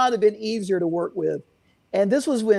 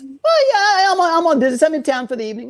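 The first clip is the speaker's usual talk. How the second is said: higher, louder retold New York accent; thick New York accent; 9 semitones higher, 5 dB louder, faster than usual. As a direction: lean hard into the New York accent of the caller. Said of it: "his accent was just getting the best of me".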